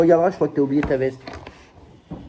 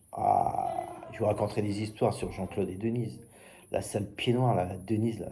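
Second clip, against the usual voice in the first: ordinary talk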